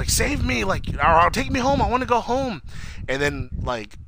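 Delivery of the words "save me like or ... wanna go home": pleading Audrey. Retold or imitated. Voicing scared Audrey pleading.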